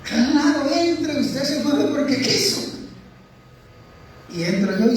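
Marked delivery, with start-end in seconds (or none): 0.0-2.6 high-pitched, indignant retort; acted rebuke. Brother lets the runaway back into the patio, rebuking. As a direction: throw it back at him, pitched well up and drawn out.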